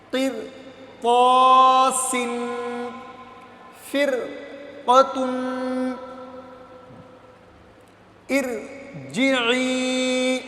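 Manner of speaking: very slow, drawn-out recitation of each word; teacher reciting the words aloud, slowly; Quran recitation. Shown as a demonstration. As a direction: chant each word long like tilawat. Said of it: "ابھی روا پڑھتے ہیں".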